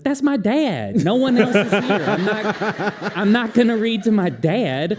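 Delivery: mock outrage at an empty reading; exasperated protest. Author protesting to staff about reading only to his dad, high laughing exasperation.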